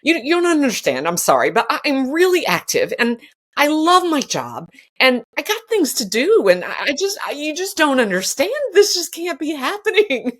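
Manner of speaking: begging the doctor to understand, near tears; retold tearful plea. Patient pleads with the hip surgeon, tearful and high. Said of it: "I just kinda burst into tears".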